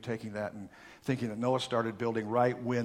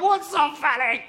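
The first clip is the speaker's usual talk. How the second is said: put-on high, indignant little-boy whine; mock crying child; 7 dB louder than usual. Little Billy as Dad laughs, mock tearful. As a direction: imitate an upset little boy. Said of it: "little Billy comes home crying".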